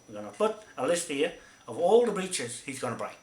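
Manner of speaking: usual speaking voice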